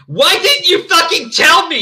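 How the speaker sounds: high, loud, drawn-out outburst; angry shout. A narrator's reading. Draco confronting Ebony, furious shouting. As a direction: shout it like a betrayed boyfriend. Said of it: "he shouted".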